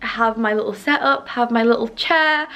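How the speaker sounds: usual speaking voice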